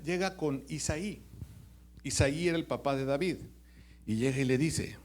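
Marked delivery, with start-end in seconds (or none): none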